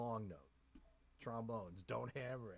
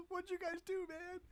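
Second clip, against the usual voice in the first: high-pitched comic outrage; Grant's mock dismay. Bandleader imagining the arranger's return, high mock dismay. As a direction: squeal it in mock protest, close to laughing. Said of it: "when Grant comes back, he'll be like".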